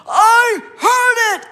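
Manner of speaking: loud, high, slow alarmed cry; startled shout. Retold or imitated. Husband waking with a start, shouting loud. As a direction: yell it like you just jumped out of bed. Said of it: "and he says".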